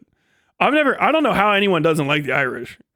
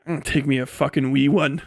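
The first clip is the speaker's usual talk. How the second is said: mock Irish accent; 4 semitones lower, 3 dB quieter than usual. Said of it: "they're like".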